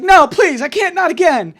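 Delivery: high, loud panicked plea; hammed-up daughter's frantic protest; mock desperate begging. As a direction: cry out each phrase in high alarm. Daughter begs father again.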